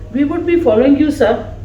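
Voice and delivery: ordinary delivery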